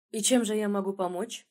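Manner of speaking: usual speaking voice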